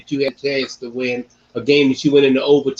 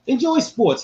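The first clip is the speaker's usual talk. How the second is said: excited urging; 11 semitones higher than usual. Acted. Closing exhortation.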